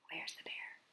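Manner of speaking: very soft secret whisper. Pretend. Asking children to find the hidden bear, whispered. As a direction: barely breathe the question. Hushed search game with kids.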